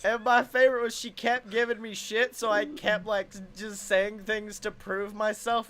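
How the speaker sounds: usual speaking voice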